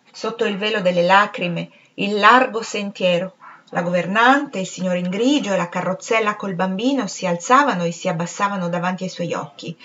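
usual speaking voice

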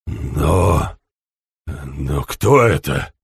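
low, slow, stunned stammer; shaken. Acted. He shudders at the body.

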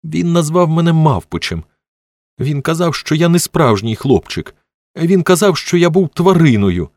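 put-on high, plaintive little boy; on the verge of bursting into tears; tearful child. A narrator's reading. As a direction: wail out each hurt to a grown-up. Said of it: "Тіммі залився потоками сліз".

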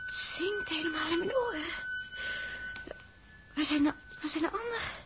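dazed waking. Acted. Waking dazed after the crash.

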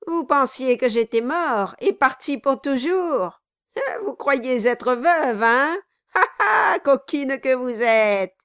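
loud, high jeering rant; bully her with a mocking laugh; loud sneering tirade at his wife; angry taunting dwarf. A narrator's reading. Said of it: "s'écria le nain".

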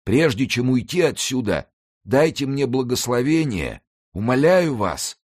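умоляющий нищий, намного выше; упрашивать о благословении, чуть медленнее в конце; мольба. A narrator's reading. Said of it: "с мольбой протянул к нему руки". (нищий на коленях умоляет коадъютора)

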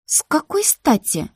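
high, indignant challenge; loud question. A narrator's reading. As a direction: demand it with pitch jumping up. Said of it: "громко поинтересовалась я". (возмущённо, высоким голосом)

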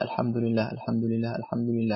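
a soft, counted repetition of الحمد لله; softly repeating dhikr. Shown as a demonstration. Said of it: "حتى إذا عد ثلاثا وثلاثين قال".

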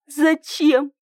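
high, tearful question. A narrator's reading. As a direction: ask it close to tears. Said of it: "В голосе нимфы слышались слёзы".

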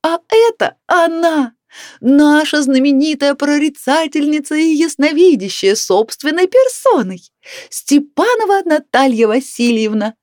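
high, slow, trumpeting proclamation; bawling announcement. A narrator's reading. Neighbour announces famous clairvoyant to canteen, bawling. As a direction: hail a celebrity to the whole room. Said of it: "Громко на всю столовую продолжала орать".

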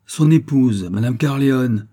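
loud, high defiant retort. Acted. Wife defying the insolent steward, loud and proud. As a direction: stand your ground, loud.

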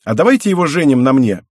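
put-on young girl eagerly volunteering to marry him, loud and high; eager blurt. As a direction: pitch it like a thrilled kid.

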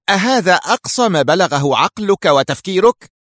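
indignant, high-pitched rebuke; exasperated, scornful outburst; angry. A narrator's reading. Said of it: "قالت في غضب".

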